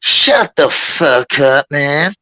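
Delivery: drawn-out mock outburst; retold outburst. American woman telling the interrupting boys off, imitated drawn-out outburst. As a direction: stretch every word out slowly, imitating her.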